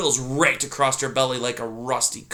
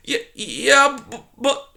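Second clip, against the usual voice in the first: frightened stammer; 12 semitones higher, slower than usual. Acted. Poppy voiced in story.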